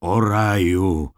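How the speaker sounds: przeciągły, głośniejszy okrzyk zachwytu; zawołaj wysoko i przeciągle. A narrator's reading. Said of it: "krzyknął Tarkle". (głośny, wolny okrzyk)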